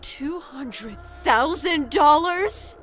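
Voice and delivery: high, slow disbelieving question; shocked mother. Acted. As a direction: echo the sum in astonishment. Mother taken aback by the sum.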